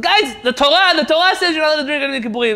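high, loud, fast frantic cry; frantic shout. Retold or imitated. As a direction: blurt it out in alarm. Leibel shouts the Torah.

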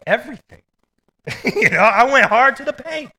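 high, loud and laughing; bursting with joyful excitement; excited. Real, not acted. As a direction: blurt it out with glee. Excited retelling of his answer.

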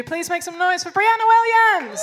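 loud, rousing call to cheer the next act; host's loud intro. Real, not acted. Host calls on the audience to cheer, loud and rousing. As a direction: pump up the crowd for the comedian.

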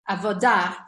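"avodah" stressed on the final DAH. Shown as a demonstration. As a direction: lean on the last syllable of "avodah".

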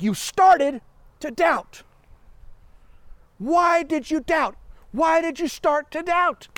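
a stern teacher-like rebuke; rebuking Jesus. Retold or imitated. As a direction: rebuke him slowly and firmly. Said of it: "He rebuked him".

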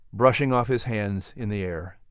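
usual speaking voice

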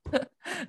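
high, helpless laughter; cracking up. Real, not acted. Host laughing at tossing out Chevy or Ford, cracking up. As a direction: break into a long high laugh.

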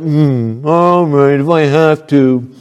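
pouting, reluctant mock-child voice; sulky kid. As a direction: stick out the lip and give in grudgingly. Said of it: "they stick out the lip".

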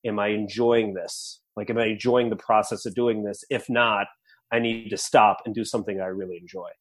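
low, soft, calm inner voice; calming self-talk. Retold or imitated. Catching myself being results oriented, calming down softly. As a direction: talk yourself down quietly, pitch dropped. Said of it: "just calm myself down".

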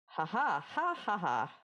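imitated stagey "ha ha" laugh; mock laugh. Retelling the boss laughing in her dream, mock laugh. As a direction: fake laugh in separate, spelt-out ha's. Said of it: "everyone starts laughing".

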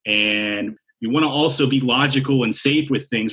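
usual speaking voice

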